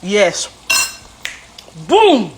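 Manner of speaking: ordinary talk, nothing marked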